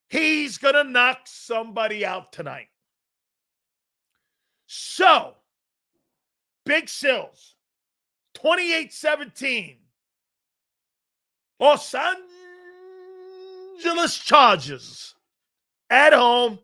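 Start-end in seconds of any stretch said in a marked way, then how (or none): 4.7-16.6 shouted, high pick called out; loud prediction. Real, not acted. Host calling his pick for the game to Sills, shouting. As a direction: belt out the final score.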